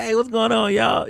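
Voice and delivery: put-on high, upbeat hey to the boys; friendly greeting. Hoping to greet the young dudes, bright friendly call. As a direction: call out cheerfully to them. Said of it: "so I could be like".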